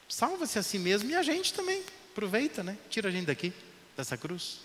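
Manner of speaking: high taunting jeer; mocking thief. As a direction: sneer it like a scornful thief. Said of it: "um ladrão vai dizer pra Jesus assim".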